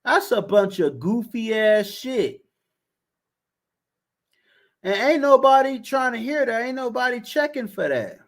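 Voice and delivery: high, exasperated dismissal in a put-on voice; mock-outraged, high-pitched shutdown; incredulous retort. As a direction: brush him off like he's talking nonsense. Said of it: "I'm like".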